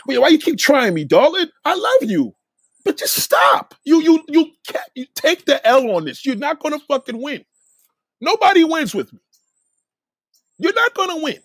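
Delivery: high-pitched, exasperated appeal; exasperated plea. Real, not acted. Telling a darling she can't win this argument, exasperated plea. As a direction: plead with rising exasperation, pitch way up.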